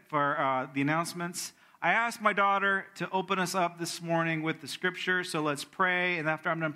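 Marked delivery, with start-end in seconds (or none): none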